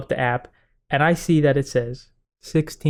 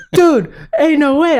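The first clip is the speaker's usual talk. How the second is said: incredulous buddy; 11 semitones higher, 4 dB louder, slower than usual. Retold or imitated. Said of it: "He goes".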